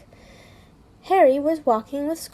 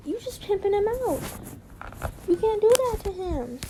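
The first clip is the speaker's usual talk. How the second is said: mock outrage; 8 semitones higher than usual. Mock outrage over cartoon boss.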